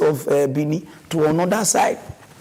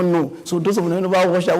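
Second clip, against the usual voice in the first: ordinary delivery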